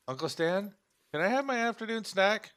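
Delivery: put-on high-pitched little kid pleading; child's voice. Boy asks Uncle Stan for his snack, high child voice. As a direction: pitch it well up like a small child.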